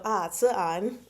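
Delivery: normal talking voice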